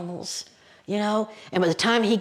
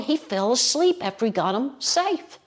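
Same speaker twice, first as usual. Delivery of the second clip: ordinary delivery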